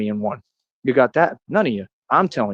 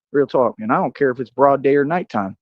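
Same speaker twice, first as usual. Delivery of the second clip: ordinary talk, nothing marked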